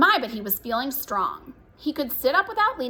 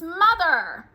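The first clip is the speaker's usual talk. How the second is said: put-on high loud child's yell; calling out; 7 semitones higher, 5 dB louder than usual. A narrator's reading. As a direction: yell for mother from upstairs.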